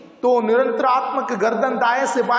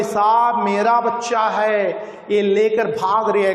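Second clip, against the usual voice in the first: ordinary delivery, nothing marked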